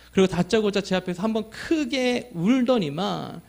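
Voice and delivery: normal talking voice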